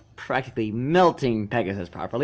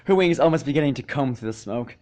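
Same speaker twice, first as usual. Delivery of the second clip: plain reading voice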